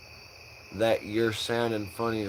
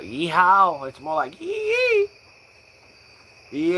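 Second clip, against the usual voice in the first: cowboy yeehaw; 8 semitones higher, 4 dB louder than usual. Pretend. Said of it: "cowboy call yeehaw".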